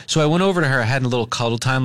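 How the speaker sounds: normal talking voice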